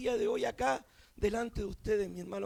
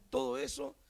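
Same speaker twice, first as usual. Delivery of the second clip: normal talking voice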